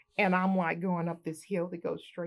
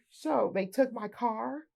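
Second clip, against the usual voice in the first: ordinary delivery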